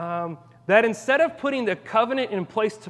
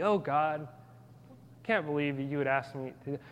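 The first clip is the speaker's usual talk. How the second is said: much softer, lower grumbling complaint; whiny mock complaint; 5 semitones lower, 8 dB quieter than usual. Pastor mimics someone complaining about God's rules, muttered softly. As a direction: grumble it like a sulky teen. Mocking people who dismiss God.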